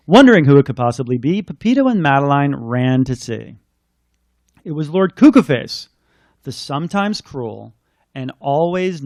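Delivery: put-on lilting read-aloud for children; storybook reading. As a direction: read it like a bedtime rhyme. Reads picture book aloud.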